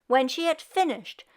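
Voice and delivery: usual speaking voice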